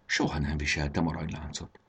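low, soft, to oneself; muttering. A narrator's reading. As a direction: murmur it, puzzled. (maga elé motyogva)

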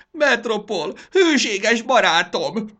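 loud, high theatrical wail; hammed-up weepy outburst; sobbing. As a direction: cry out tearfully, drawing it out.

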